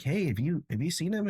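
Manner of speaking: high, casual asking; curious classmate. Retold or imitated. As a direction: ask it lightly, curious.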